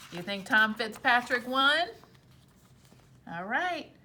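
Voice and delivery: usual speaking voice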